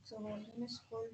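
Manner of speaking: very faint, distant voice; almost lost, far from the mic; barely audible. Real, not acted. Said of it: "बहुत कम आवाज आ रही है".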